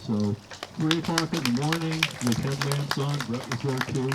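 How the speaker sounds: ordinary talk, nothing marked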